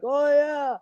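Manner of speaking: high, drawn-out tipsy agreement; lightly mocked drunken eagerness; drunk customers. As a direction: imitate drunk patrons happily going along. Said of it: "everybody's drunk".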